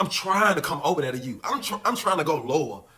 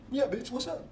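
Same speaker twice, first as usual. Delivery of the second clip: higher, softer put-on taunt; taunting boyfriend; 7 semitones higher, 7 dB quieter than usual. Boyfriend taunting him from the other cell, soft higher mock taunt. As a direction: tease him quietly to get a rise.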